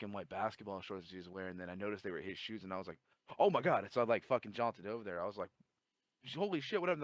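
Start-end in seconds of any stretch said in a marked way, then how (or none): 3.4-3.8 shocked. Retold or imitated. Sees friend collapsed. 6.3-7.0 alarmed. Retold or imitated. Runs to fallen friend.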